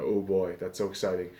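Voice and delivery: deadpan, low and flat fake excitement; mock thrill with no life in it; sarcastic enthusiasm. Vlogger mocking preseason with sarcastic deadpan. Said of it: "You can hear the enthusiasm in my voice".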